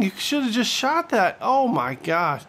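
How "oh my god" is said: ordinary talk, nothing marked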